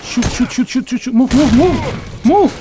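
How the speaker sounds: high, urgent battle commands; frantic shouting. Real, not acted. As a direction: bark the orders in panic. Frantic firefight in game.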